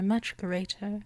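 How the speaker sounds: normal talking voice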